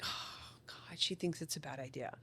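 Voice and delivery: whispered dismay. Retold or imitated. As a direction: whisper it like the kid fears mom. Kids react to parent's cue.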